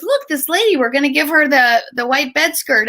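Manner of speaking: mother at the door telling her child about the visitor, mildly voiced; imitating a little girl. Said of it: "she stood at the door and she goes".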